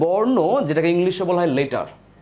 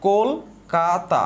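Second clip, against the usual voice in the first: "Kolkata" very slowly as কোল, কা, তা; syllable by syllable; much slower than usual. Shown as a demonstration. Teacher asking students to notice, syllable by syllable. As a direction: stretch out and separate "Kolkata". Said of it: "খেয়াল করে দেখেন কি বলেছি".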